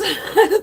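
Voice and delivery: high loud giggle; burst of laughter. Real, not acted. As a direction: crack up laughing. Laughing about stomping.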